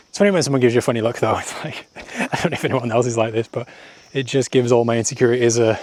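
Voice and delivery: normal talking voice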